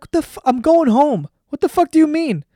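high, loud, incredulous outburst; angry disbelief. Retold or imitated. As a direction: snap back at the friend who wants to keep training. Refusing friend, going home.

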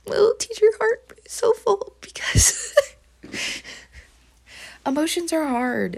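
very high, squeaky emotional voice; tiny high-pitched melting voice; touched gushing. Real, not acted. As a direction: squeal it, overcome with fondness. Gushing over child's kind words.

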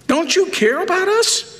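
high-pitched mock panic; freaking-out disciples. Disciples to sleeping Jesus in the boat, freaking out. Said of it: "the disciples are freaking out".

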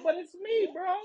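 whiny manager. Retold or imitated. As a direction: whine like a caught manager.

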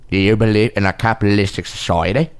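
a hint of Cockney, low and measured; lightly voiced English film star; Michael Caine voice. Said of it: "your Michael Caine".

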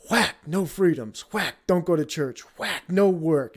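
mock blows. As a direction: slam each command like a stick. Acting out authorities hitting people.

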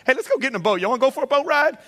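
high, lightly voiced, breezy invitation; Jesus casually inviting the disciples for a boat ride; playful Jesus. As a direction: play a teasing Jesus luring them aboard. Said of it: "And Jesus says".

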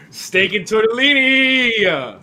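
loud, drawn-out exclamation; excited. Real, not acted. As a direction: belt it out slowly, thrilled. Excited echo of dinner menu.

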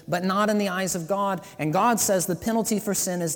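loud, high, driving sermon voice; preaching at full force. Acted. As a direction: press the point home to the crowd. Preaching to the congregation.

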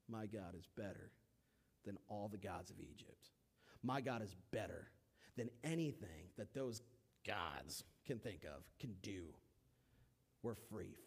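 low, soft, proud murmur; hushed pride. Acted. Israelites quietly marvelling at their freedom, low and soft. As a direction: savour it under your breath.

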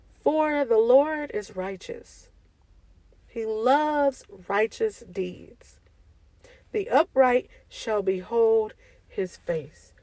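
slow, solemn reading of scripture. Real, not acted. Preacher reading a psalm to the BC family, slow and solemn. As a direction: read the verse slowly and reverently. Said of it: "and it reads".

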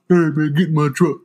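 a hint of a cocky guy; lightly voiced smooth-talking dude; dropped dude voice. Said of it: "the same way your voice drops".

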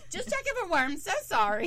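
high sheepish excuse; sheepishly apologize, pitch up; mock embarrassed apology. Wes caught by the Coast Guard, sheepish apology.

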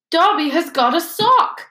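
high, loud elf amazement; disbelieving elf. A narrator's reading. Elf who has got a sock, in disbelief. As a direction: cry it out as if unable to believe it. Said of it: "said Dobby in disbelief".

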